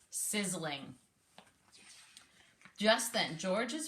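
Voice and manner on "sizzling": ordinary delivery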